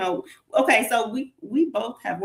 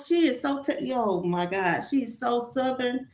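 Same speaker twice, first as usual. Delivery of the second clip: ordinary talk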